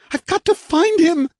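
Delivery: high, loud struggling cry; fighting to break free; frantic woman. A narrator's reading. Kay fighting them.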